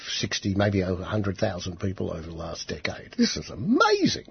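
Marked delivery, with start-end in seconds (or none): 3.2-4.2 high, incredulous outburst; high outraged exclamation. Real, not acted. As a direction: voice jumps way up on amazing. Outraged at death toll.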